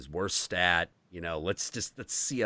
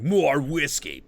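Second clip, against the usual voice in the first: slow, louder cowboy drawl; John Wayne; 6 semitones higher, 7 dB louder, slower than usual. Retold or imitated. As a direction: order a whiskey like an old western hero. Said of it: "gotta have that John Wayne haymaker".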